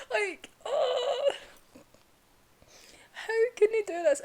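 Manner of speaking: high, loud wail of protest; upset. Real, not acted. Fan protesting what the story does, high upset wail. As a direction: lament it loudly, high-pitched, between laughing and crying. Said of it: "I'm actually getting upset again".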